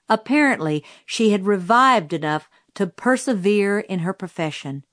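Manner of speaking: plain reading voice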